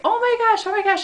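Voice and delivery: high-pitched imitation of a tickled friend; squealing friend. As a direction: mimic a high, flustered squeal. Acts out friend being tickled.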